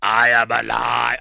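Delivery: slow, monotone machine-child imitation; Proteus four. Film fan repeats what set his wife laughing, droning Proteus voice. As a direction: stretch it out on one droning note. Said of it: "In the Proteus four voice".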